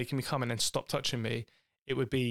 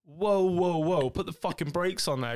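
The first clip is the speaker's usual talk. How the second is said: louder, higher startled whoa, whoa; mock alarmed protest; 6 semitones higher, 4 dB louder than usual. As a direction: hit the brakes, half-joking but loud. Mock scolding partner's outburst.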